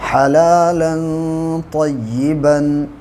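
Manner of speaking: slow tajweed recitation of हलालन तय्यिबन; Quranic recitation. Shown as a demonstration. Teacher demonstrating tajweed to students, slow recitation. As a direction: draw out हलालन तय्यिबन with nasal tanween. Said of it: "दो ज़बर की तनवीन है और ता है".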